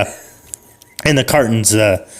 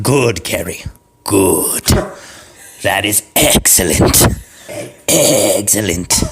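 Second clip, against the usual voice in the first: slow, lower, savouring praise; sinister egg voice; 4 semitones lower, slower than usual. Acted. The eggs praise Gary after the kill, slow and lower. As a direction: purr it like a pleased villain, slowly.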